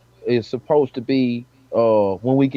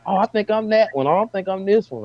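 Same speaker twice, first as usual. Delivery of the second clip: higher-pitched mock wonder, hand on the shirt; imitating curious people; 8 semitones higher than usual. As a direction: play a curious stranger matching their own colour.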